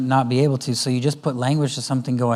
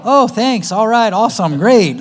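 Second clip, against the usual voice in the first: high, loud mock chirpy thanks; high sarcastic thank-you; mock grateful kid; 7 semitones higher, 7 dB louder, slower than usual. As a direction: imitate a kid faking gratitude. Mock kid's fake gratitude.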